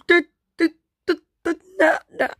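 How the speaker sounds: high, choked stuttering; high, broken play-acted panic; horrified stammer. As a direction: stammer in acted shock, unable to get it out. Stammering on finding friends dead.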